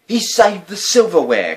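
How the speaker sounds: loud, high joyful exclaim; excited cry. A narrator's reading. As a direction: cry out with delight. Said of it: "cried Mother".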